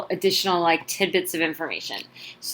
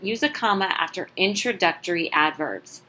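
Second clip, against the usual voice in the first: usual speaking voice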